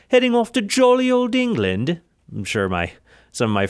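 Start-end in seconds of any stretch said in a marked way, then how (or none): 0.1-2.0 much higher, hammed-up British accent; horrendous British accent. Podcaster jokes about travelling to England, horrendous British accent. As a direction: put on a mock-British voice, high and theatrical. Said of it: "horrendous British accent".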